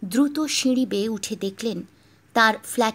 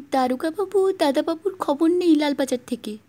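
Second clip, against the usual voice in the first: higher teasing maid voice; imitating the maid; 6 semitones higher than usual. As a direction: keep up the mock maid. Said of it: "ললিতার গলা নকল করে".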